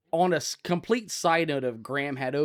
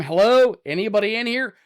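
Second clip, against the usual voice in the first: high, loud searching call; 7 semitones higher, 8 dB louder than usual. Retold or imitated. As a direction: shout into the room for whoever's there. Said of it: "I'm a loud guy".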